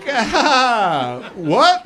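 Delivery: hammed-up double take; mock shock. [name] to DMV clerk, hammed-up surprise.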